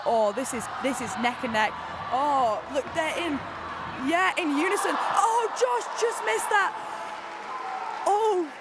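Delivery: thrilled commentator gasping at a slip; excited race call. Real, not acted. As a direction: shout the near-miss then sag into disappointment. Tight race, one climber slips.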